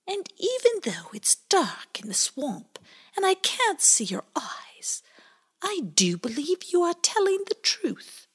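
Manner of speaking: calm eerie whisper; a mysterious fairy speaking in hushes. A narrator's reading.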